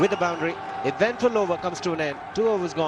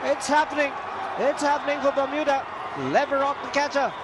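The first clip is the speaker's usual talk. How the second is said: excited commentary; 9 semitones higher than usual. Real, not acted. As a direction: call the catch in sheer delight. Wicket falls, commentator erupts.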